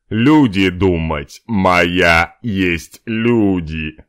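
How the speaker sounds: slow, loud broken monster speech; caveman. Acted. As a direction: grunt it like a caveman. (пещерный человек)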